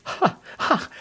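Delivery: breathy amused chuckle; light laugh. A narrator's reading. Holmes laughs at the departed visitor, light chuckle.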